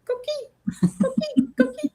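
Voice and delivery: high chirpy frog call; coqui frog call. Retold or imitated. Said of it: "That was a horrible coqui impression".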